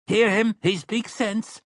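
storekeeper piping up from the crowd; calling out agreement. A narrator's reading. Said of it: "called out".

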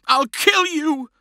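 high, slow furious scream; screaming child. Acted. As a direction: scream it at him in rage. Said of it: "he screamed".

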